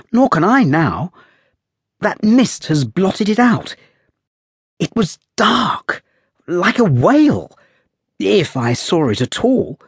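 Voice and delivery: put-on light, high, lively young lady; playful high girl's voice, teasing; young woman's voice. A narrator's reading. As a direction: raise the voice into a spirited young woman. Girl voiced in story.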